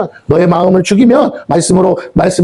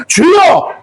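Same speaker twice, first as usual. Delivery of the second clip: high, shouted invocation; prayer cry; 12 semitones higher, much slower than usual. Shown as a demonstration. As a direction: cry out to the Lord at a high pitch. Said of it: "기도해야 되는 게".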